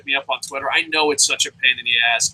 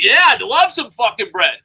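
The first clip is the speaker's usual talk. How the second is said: higher, louder mock enthusiasm; hammed-up keen yes; acted eager reply; 8 semitones higher, 6 dB louder than usual. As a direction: accept the offer with put-on eagerness. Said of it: "And I'm like".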